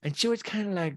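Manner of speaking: normal talking voice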